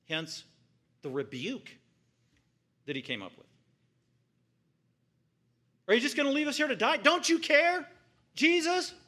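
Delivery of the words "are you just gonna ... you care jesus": fast, high, loud reproach; panicked accusation. Retold or imitated. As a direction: cry out as if drowning. Disciples accusing Jesus in storm.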